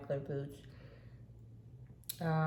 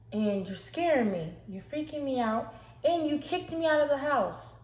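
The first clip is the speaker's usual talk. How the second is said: raised, imitated upset voice building louder; accusing outburst; 8 semitones higher, 6 dB louder than usual. Rebecca confronts her sister over being kicked out, accusing.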